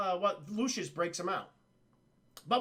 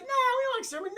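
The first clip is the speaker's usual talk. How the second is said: pitched way up, mock-prim objection; high ape lady voice; 4 dB louder, much faster than usual. Ape doctor protesting the extermination, high prim voice.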